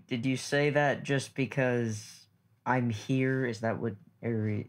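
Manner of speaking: normal talking voice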